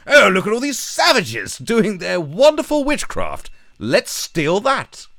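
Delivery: high, loud, fake-posh coloniser gushing, last words drawn out slowly; mock colonial Brit. Mocking British colonists in Africa, high, loud and pompous. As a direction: ham up a snooty Victorian admiring the savages. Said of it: "went to Africa and went".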